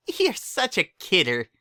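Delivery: high, slow bubbly pony voice; squeaky cheerful pony; high excited Pinkie voice; Pinkie's voice. A narrator's reading.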